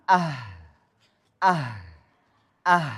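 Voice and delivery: "ah" with the breath running on; airy, unstopped end on "ah"; flowing ha. Shown as a demonstration. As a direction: let the h of "ah" flow out in a long exhale. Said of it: "maka suara akan mengalir".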